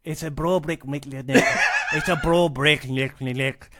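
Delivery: amateur stab at a Scottish phrase; copied Scottish accent. Pretend. Guest copies the host's phrase, clumsy Scottish accent. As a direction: parrot the Scottish saying, tentatively. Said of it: "All right, a Scottish accent?".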